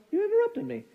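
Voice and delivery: mock high note; lightly voiced calm parent; a touch of a prim parent; imitating parent. Parents calmly pointing out interruption.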